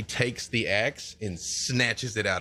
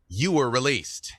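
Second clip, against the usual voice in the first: ordinary talk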